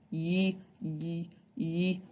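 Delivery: quiet ye ye; tongue up, ye; soft Y sound. Shown as a demonstration. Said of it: "Next one is Y".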